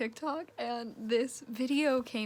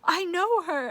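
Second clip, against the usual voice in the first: squealing high and loud; excited; 8 semitones higher, 7 dB louder than usual. Retold or imitated. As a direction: burst out in delighted disbelief. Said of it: "and I was like".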